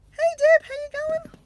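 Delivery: very high, loud, squeaky duck voice; talking duck. Pretend. Duck socks greet Deb online, talking duck voice. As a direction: squeak out a hello as a toy duck. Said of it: "my ducks are talking to you".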